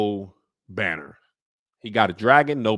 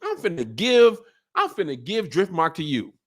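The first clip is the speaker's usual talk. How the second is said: much higher, hyped; high excited giveaway; 9 semitones higher than usual. Retold or imitated. Old lord gifting Driftmark to granddaughter, high and hyped. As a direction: gush as he hands it over. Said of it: "he said".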